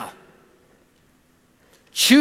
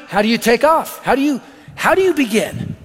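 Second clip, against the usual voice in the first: normal talking voice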